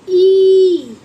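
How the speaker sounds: ई called out loud and slow; stressed ई. Shown as a demonstration. Teacher stressing ई for the class. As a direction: stretch the ई loudly, high. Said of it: "ई यानी कि".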